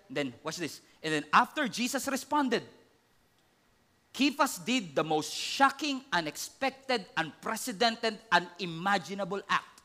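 ordinary talk, nothing marked

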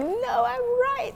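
high, drawn-out mock protest; stubborn insistence. Person refusing the facts, stubbornly insistent.

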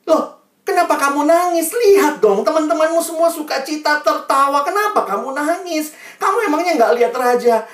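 high, loud, insistent questions; questioning teacher. Acted. Teacher keeps asking crying pupil, loud insistence. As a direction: demand an explanation from the child. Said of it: "gurunya datang sama dia".

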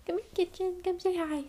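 high, soft coaxing call; calling a pet. Real, not acted. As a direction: coax the cat over sweetly. Calling her cat over.

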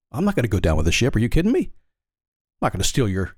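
ordinary delivery

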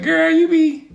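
high-pitched imitation of women talking; women's banter. Man imagining women teasing each other, high mock-female voice. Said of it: "Do women do that shit too?".

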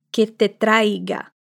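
que te traiga drawn out slowly; slow model phrase. Shown as a demonstration. Teacher models the Spanish form slowly for learners. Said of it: "então fica".

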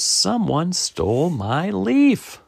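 high, drawn-out squirrel lament; distressed Squirrel. Acted. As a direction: wail it slowly, high and aggrieved. Squirrel announces the theft.

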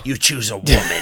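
loud mocking villain line; villain taunt. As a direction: jeer it like a villain. Quoting movie villain.

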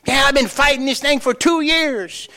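mock griping, high-pitched whine; hammed-up high grumbling; griping complaint. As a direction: imitate someone complaining in a high, strained voice. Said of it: "she's griping and complaining".

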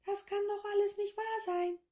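very high squeaky voice parroting the words; high squeaky creature copying the professor; squeaky echo. A narrator's reading. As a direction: echo it back in a tiny squeak. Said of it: "mit einer piepsigen Stimme".

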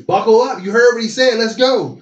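loud, high sideline holler; rally the players like a coach calling out; imitated coach's call.

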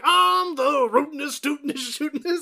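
Southern cowboy. Retold or imitated. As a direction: stretch it out like a cartoon cowboy, ending in a laugh. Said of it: "he's like".

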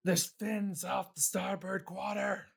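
high, urgent lookout's call; shouting from crow's nest. Acted. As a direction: yell the warning down from the mast. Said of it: "one of the shipmates call to you from the crow's nest".

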